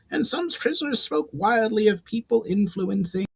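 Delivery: put-on a thin goblin mage puzzling over old war accounts. Goblin mage voiced by narrator.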